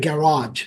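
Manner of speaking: garage as "ge-RAAZH", long open second vowel; English "garage". Shown as a demonstration. Teacher repeats it for learners, English pronunciation. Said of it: "ইংলিশে তো বলে গ্যারাজ".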